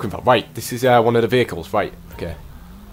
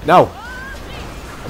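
usual speaking voice

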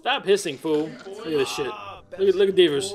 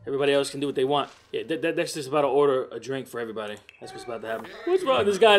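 ordinary delivery

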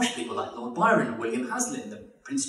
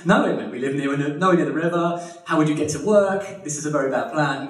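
louder, emphatic wifely protest; objecting wife; 4 dB louder than usual. Retold or imitated. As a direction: talk him out of it, with force. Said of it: "She says".

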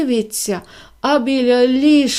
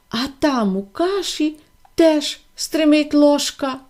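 plain reading voice

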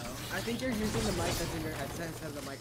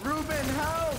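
loud urgent call for help; 9 semitones higher, 7 dB louder, much slower than usual. Real, not acted. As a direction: yell to a teammate for help. Calling teammate for help mid-fight.

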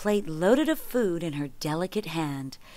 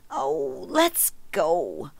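whining; 6 semitones higher, much slower than usual. A narrator's reading. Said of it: "whined Sun".

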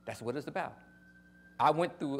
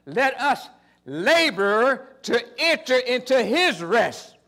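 loud, slow, high preaching; preaching scripture; 8 semitones higher, 10 dB louder, much slower than usual. Acted. Preacher reading Hebrews to the church, slow and loud. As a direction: stretch each word out to the church. Quoting Hebrews at full force.

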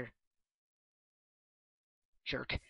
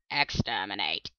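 Dalek voice; 7 semitones lower, 4 dB quieter, slower than usual. Acted. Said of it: "I'm a Dalek".

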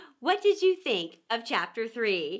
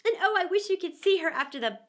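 high, bright, chattering on; eager; 7 semitones higher than usual. Acted. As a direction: gush about her pet. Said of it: "Alice replied eagerly".

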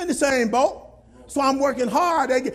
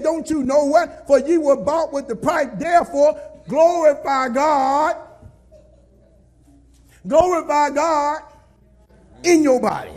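preaching scripture; 2 semitones higher, 5 dB louder than usual. Acted. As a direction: proclaim the verse with full force. Reads scripture with force.

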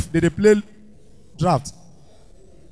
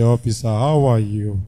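much lower, heavy deep voice; thick voice; 12 semitones lower, 5 dB quieter than usual. Shown as a demonstration. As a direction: drop the voice low and heavy. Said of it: "Thick your voice".

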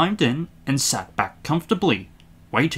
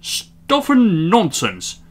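slow, raised, emphatic rebuke; scoffing station master; 8 semitones higher, 4 dB louder, much slower than usual. A narrator's reading. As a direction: dismiss it word by word. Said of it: "said the station master".

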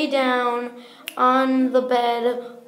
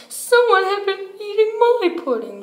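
high, quick complaint, less booming; Mama Bear's middle-sized voice; 8 semitones higher, 3 dB louder, faster than usual. Acted. Mama Bear finds her pudding eaten, middle-sized voice. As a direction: pitch it up high and fast, an indignant storybook bear. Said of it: "in her middle-sized voice".

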